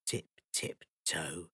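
slow, very soft creeping words; hushed tiptoe. A narrator's reading. Tiptoeing in a dark house.

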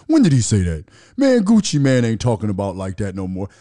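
loud dismissive mock of a friend. Quoting friends who dismiss him, loud mock scoff.

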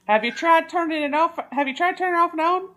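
lightly mock customer-service voice; mock tech support. Friend mocking tech support, chirpy helpdesk voice.

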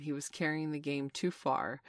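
plain reading voice

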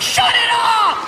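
very high, drawn-out shout; cop shouting. Acted. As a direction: shriek the order, stretched out. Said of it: "and he's like".

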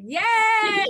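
a big high cry of joy; joyful cheer. Real, not acted. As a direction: cheer out loud to greet them. Excited greeting of the guests.

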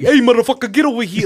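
loud, high mock shout of a pushy vendor; retold shout. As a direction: yell him over like a pushy seller. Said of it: "He's like".

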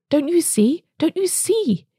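rising, breathless urgency on the repeat; feverish cry. A narrator's reading. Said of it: "he cried feverishly".

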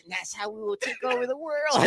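high, soft, gloating villain voice; mustache twirling villain. Pretend. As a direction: plot world domination like a cartoon baddie. Villain bit continues.

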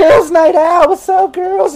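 high, loud tipsy squeal; drunk party girl. Acted. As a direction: greet them like a wasted party girl. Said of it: "she's coming over like".

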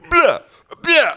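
high mock French blah blah; imitating a fake French babble; fake foreign language. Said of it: "Alex Jones keeps going".

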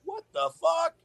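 high shouted what the fuck; mock disbelief. Man recalling lockdown, hammed-up shocked shout.

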